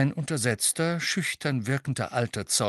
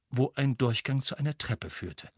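usual speaking voice

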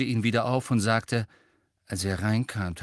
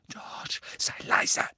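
sharp, voiceless whispered scolding; hissed reprimand; slower than usual. A narrator's reading. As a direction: hiss at your son to hush. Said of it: "fauchte Misses Weasley". (zischend gefaucht, im Flüsterton)

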